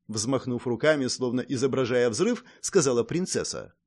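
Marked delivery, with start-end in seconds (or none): none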